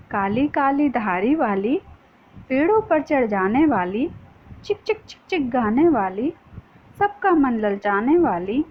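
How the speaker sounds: put-on sing-song rhythmic reading of a children's poem; poem recitation. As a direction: recite it with a lilting beat for kids. (बच्चों को कविता लय में सुनाना)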